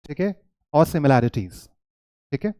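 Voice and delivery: ordinary talk, nothing marked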